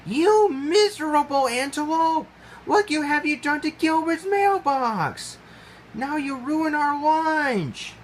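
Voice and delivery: high, loud furious tirade, slowing at the end; angry scolding. Acted. Gwen scolding the impala for the mailbox, furious. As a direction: tear into him.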